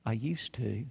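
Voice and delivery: soft, low admission; subdued confession. Retold or imitated. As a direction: confess it quietly, understated. Man's sad confession.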